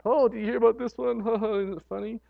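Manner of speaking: lightly voiced, quick chuckling joke sharer; a hint of a sniggering gossip; joke teller. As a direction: share the dirty joke with a giggle. Person sharing off-color joke.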